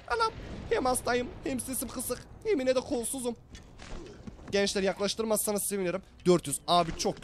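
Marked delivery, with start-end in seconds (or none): 0.1-3.4 high, whimpering mock self-pity; mock lament. Streamer bemoaning being sick and hoarse, mock whimpering lament. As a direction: wail your woes in a high voice.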